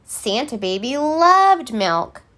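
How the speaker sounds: ordinary delivery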